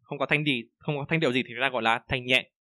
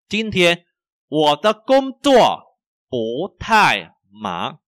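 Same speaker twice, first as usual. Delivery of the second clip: slow, loud, high-toned sentence drill; measured words for repeating; language teacher model reading; 5 semitones higher, 8 dB louder, much slower than usual. Shown as a demonstration. (đọc mẫu chậm, to và rõ)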